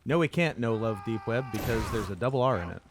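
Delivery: normal talking voice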